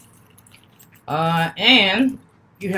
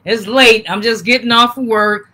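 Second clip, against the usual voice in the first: normal talking voice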